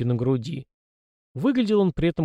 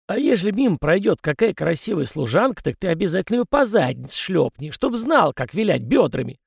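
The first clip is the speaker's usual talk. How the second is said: put-on high, cranky old ghost; a fussy old spirit lecturing; 6 semitones higher than usual. Ghost Kozyrev's voice. (ворчливый старый призрак)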